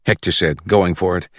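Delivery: ordinary delivery, nothing marked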